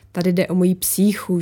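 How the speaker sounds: ordinary delivery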